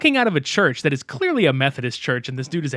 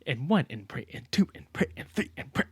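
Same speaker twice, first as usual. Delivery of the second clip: fading count; 6 dB quieter than usual. Pretend. Mock workout exorcism count.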